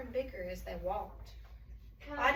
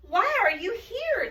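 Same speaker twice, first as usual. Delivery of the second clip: high, louder questioning animal voice; curious animals; 8 semitones higher, 7 dB louder, slower than usual. A narrator's reading. Animals asking Santa, high curious question. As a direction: ask it high and eager.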